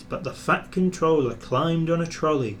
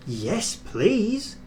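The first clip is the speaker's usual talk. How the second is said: higher, eager little engine; happily; 8 semitones higher than usual. A narrator's reading. James to the fat controller, happily. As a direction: answer with delight. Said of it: "answered James happily".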